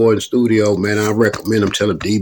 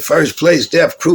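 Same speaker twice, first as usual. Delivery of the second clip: mock announcer; 9 semitones higher, 5 dB louder, slower than usual. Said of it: "they said".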